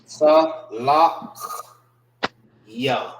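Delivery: "Sa, Lach, Ya" with pauses between; throaty kh on "Lach", then a separate "Ya"; syllable-by-syllable. Shown as a demonstration. Teacher drilling the word's parts, separated syllables. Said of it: "These are pronounced together".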